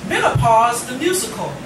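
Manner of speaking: ordinary delivery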